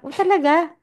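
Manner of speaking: mock surprise. Surprised reaction voiced in story.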